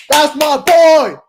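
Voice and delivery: high, drawn-out excited shout; freaking out. Retold or imitated. Fan reacting first in the theater, freaking out. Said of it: "I'm really freaking out".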